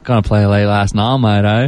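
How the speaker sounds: put-on Aussie accent. Host asks [name] for it, Aussie accent with a laugh. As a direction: drop into a broad Australian accent, mate. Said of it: "say it in an Aussie accent for us".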